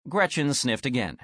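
ordinary delivery

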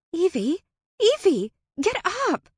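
high, loud wake-up calls; excited shout. A narrator's reading. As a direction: bounce the name out, eager. Friend shouting her awake.

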